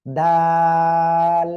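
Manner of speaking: loud দাল, "aa" held long on a level note, closing on l; long drawn-out. Shown as a demonstration. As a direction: intone দাল very slowly. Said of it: "তিন আলিফ টেনে পড়তে হবে".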